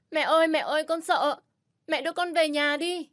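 put-on high, loud shrieking little girl; terrified crying child. Frightened little girl wailing to her mother to go home. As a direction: sob out for mommy. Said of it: "Tít sợ hãi khóc ré lên".

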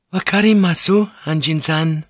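high, thin woman's voice; thin, nervous. A narrator's reading. Fujiko answers Blackthorn about guarding the guns, thin and nervous. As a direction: answer nervously, pitched well up. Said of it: "she replied in a thin, nervous voice".

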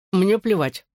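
talking through a mouthful; mouth full. Acted. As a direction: say it round a bite of sausage. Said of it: "с набитым ртом сказала она".

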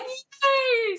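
very high squealed yay, near a cry; thrilled yay. Real, not acted. Friend just said she is going vegan, squealing yay. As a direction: squeal with joy.

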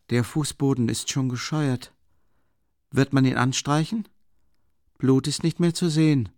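Raskolnikow musing. Acted. Voices Raskolnikow questioning.

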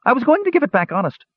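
very high, quick gnome whine; squeaky, cringing little gnome; whining gnome. A narrator's reading. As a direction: whine defensively, high and fast. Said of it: "whined the gnome".

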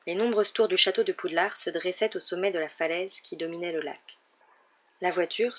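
plain reading voice

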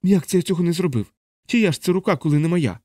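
high, indignant questions; bewildered protest. Acted. Chaplain protests the accusation.

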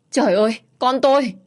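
high, loud cry of grief; mother wailing at her daughter's death; anguished wail. A narrator's reading. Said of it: "chỉ kịp thốt lên một tiếng".

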